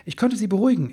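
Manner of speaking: proud little boast, voice lifting; a bit proud. A narrator's reading. Elf woman proudly reports calming them, a bit proud. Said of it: "ein bisschen stolz".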